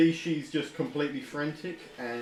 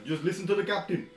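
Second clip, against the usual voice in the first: put-on Ashtaran accent; 8 semitones higher, 4 dB louder than usual. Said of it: "Gnu's like".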